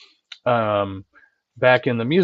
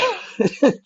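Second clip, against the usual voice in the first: short high laugh; 14 semitones higher than usual. Real, not acted. Laughing at own embarrassing story.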